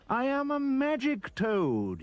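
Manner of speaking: high, loud and slow proud toad; magic toad's cry. A narrator's reading. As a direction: crow it out like a showy magic toad. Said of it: "he cried".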